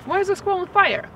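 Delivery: high, loud rising question. Shown as a demonstration. Teaching the phrase, asked as an excited question. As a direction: ask it high with real alarm. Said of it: "Question mark".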